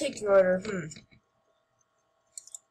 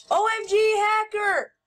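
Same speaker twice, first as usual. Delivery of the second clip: excited outburst; 7 semitones higher, 3 dB louder than usual. Real, not acted. Shocked by a duplicate player.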